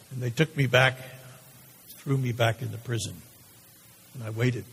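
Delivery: low, hushed, heavy with dread; condemned man's subdued recollection. Acted. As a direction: sink into a quiet, low register with long pauses. Condemned man dreading his death in monologue.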